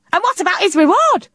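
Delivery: high, insistent demand; a dodo pressing for a reward. A narrator's reading. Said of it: "demanded Dodo".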